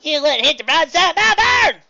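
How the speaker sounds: high, loud indignant yell; angry shout. Acted. Swiftbell yelling at the outfield, angry shout. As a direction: roar the complaint. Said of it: "cried Swiftbell".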